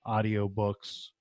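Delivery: ordinary delivery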